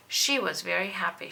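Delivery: ordinary delivery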